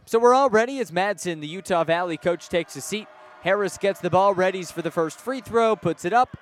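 ordinary talk, nothing marked

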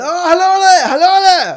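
very high, loud comic hailing; mock calling out. Wristband seller calling after a departing tourist, high loud shout. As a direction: imitate a shrieky call down the road.

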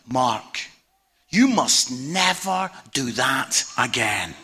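low, slow, mildly stern pastor; grave verdict. Retold or imitated. Pastor to the comedian after his first show, low and grave. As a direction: deliver a measured, grave reprimand.

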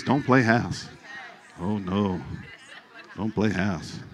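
quiet, concerned "Don't play house". Shown as a demonstration. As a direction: say "Don't play house" with real worry, softly. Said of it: "Do it like this".

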